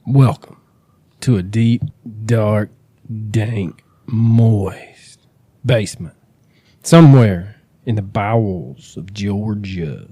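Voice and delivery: slow, hammed-up creepy host intro; mock spooky welcome. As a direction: draw out each gloomy word to set a creepy mood.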